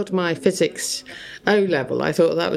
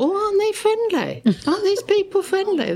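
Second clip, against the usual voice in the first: much higher, wide-eyed innocent delight; naive young self; 13 semitones higher than usual. Retold or imitated. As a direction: sound charmed and clueless about the men waving. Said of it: "and I thought".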